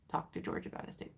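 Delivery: fast whispered aside; quick whisper. Real, not acted. Reminding herself about George, in a fast whisper. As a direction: whisper a reminder under your breath.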